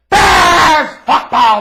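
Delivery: slow, full-throated yell; unhinged shout. Shown as a demonstration. Host delivers the Bears punchline fans wait for, screaming. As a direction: scream it like you've lost it. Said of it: "to be unhinged when he says it".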